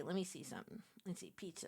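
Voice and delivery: ordinary talk, nothing marked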